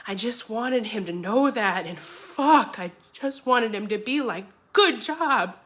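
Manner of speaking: frustrated plea, climbing to a peak; emotional outburst. Acted. Pleading for brother's approval, voice climbing.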